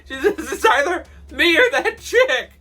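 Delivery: high, loud words through laughter; can barely get it out for laughing; laughing while telling. Real, not acted.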